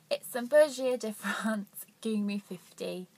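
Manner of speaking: playful over-the-top French accent; mock French accent. Shown as a demonstration. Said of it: "This is my French accent".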